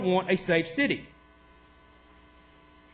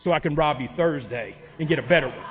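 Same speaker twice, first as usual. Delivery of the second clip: mock robber; 8 semitones lower than usual. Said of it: "they come back to you and said".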